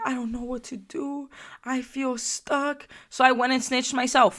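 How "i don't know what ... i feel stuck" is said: higher, softer mock-crying voice; imitated crying. Storyteller imitates a crying girl who feels stuck, mock-tearful. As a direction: imitate a helpless girl whining, slowing at the end. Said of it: "like, crying. She's like".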